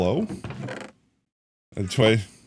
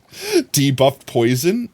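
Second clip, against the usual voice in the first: ordinary delivery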